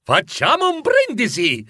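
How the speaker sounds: high, festive call; ringing exclamation. A narrator's reading. Bob calling the family to toast, bright and ringing. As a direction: ring out a cheerful toast. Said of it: "la voce squillante di Bob Cratchit".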